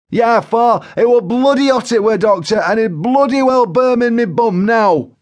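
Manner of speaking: loud, high-pitched indignant outburst; Joe Windass, anguished complaint. A narrator's reading. Joe confirms the hot curry, in loud high anguish. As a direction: wail about the burning in protest.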